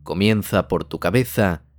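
unhurried meditation guide, pausing between phrases; measured, calming delivery in short phrases; guided meditation. Acted. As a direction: lead the listener gently into relaxation, leaving space to breathe.

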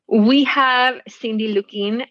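normal talking voice

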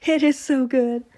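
high, loud laughing words; real joyful laughter. Real, not acted. Laughing, moved by reading.

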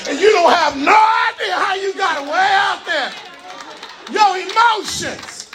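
rising, shouted sermon cadence; preaching at full force. Acted. Preacher driving the point home to the church, shouting.

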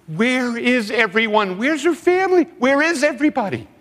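high rising lament; anguished plea. Real, not acted. Preacher asking where her family is, pleading high.

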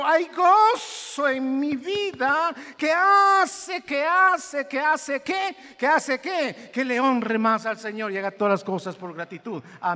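slow, loud, high preacher's cry; impassioned sermon voice; full-force preaching. Real, not acted. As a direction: preach it out slowly with fervor.

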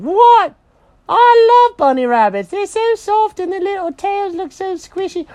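very high excited squeal; cartoon cat delighted by bunnies; thrilled cat. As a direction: burst out loud, high and gushing. Jenkins thrilled about bunnies.

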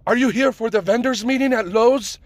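high-pitched mock Middle Eastern accent; bad Middle Eastern accent. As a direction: ask in a poorly imitated Middle Eastern accent. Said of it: "I'm doing the fucking accent, the shitty accent".

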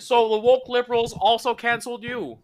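high-pitched mock podcast host; put-on high interviewer voice; Jordan Peterson. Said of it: "we go on Jordan Peterson's podcast".

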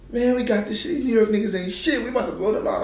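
cocky player's taunting, high-voiced; Evan Turner. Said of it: "Evan Turner's talking wild shit".

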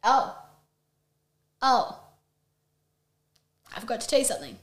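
quiet. Acted. Fake solemn announcement setup.

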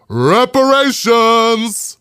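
long, ringing proclamation of REPARATIONS; drawn-out announcement. Real, not acted. Host reveals what the check really means, drawn-out proclamation. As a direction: stretch REPARATIONS out very slowly, each syllable held.

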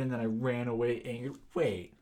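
ordinary delivery

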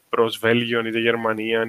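usual speaking voice

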